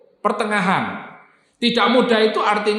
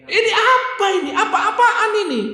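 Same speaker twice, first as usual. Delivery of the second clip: very high, incredulous angry cry; hammed-up outraged squeal; indignant outrage. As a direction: act the scandalised crowd. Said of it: "Marah-marah ini, Pak".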